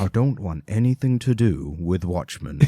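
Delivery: low put-on voice of a disgruntled author; grumpy writer. Comics writer disowning Watchmen, low grumpy voice.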